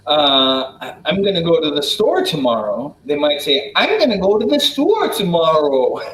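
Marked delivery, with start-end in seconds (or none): 3.8-6.0 Upper Midwest lilt with long vowels, pitched high; playful Wisconsin sing-song, vowels drawn long; sing-song Wisconsin accent. Shown as a demonstration. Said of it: "have it go up and down like that".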